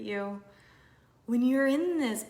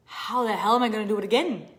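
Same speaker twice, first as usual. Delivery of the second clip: panicked thought; 9 dB louder, faster than usual. Retold or imitated. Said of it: "you're like".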